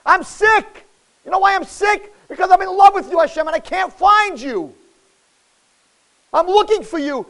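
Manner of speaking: high, loud lovesick plea; impassioned cry of love; Shlomo HaMelech. Acted. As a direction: call out in anguished longing for God. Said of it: "said Shlomo HaMelech".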